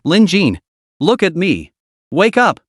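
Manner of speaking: rapid, panicked calls, pitch rising; frantic calling. A narrator's reading. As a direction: call out fast and frantic. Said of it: "calling out in rapid succession".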